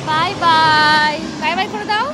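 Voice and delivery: loud, high, stretched bye bye to the sky; excited calling out. Real, not acted. Mother urges Nabiha to wave at the plane, calling out loudly. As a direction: call out to the plane as if it could hear.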